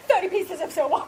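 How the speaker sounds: high-pitched, helpless laughter through the words; laughing exclamation. Real, not acted. Teller cracking up over the thirty dollar price, laughing exclamation. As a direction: say it while cracking up.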